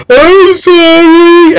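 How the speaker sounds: high, drawn-out mock wail; fake crying apology. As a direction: whine it loudly like a little boy faking tears. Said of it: "fake emotion to get away with anything".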